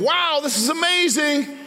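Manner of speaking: high, slow, amazed exclamation; excited wow. Retold or imitated. Peter marvelling on the water, high and awed. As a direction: gasp out the wonder of walking on water.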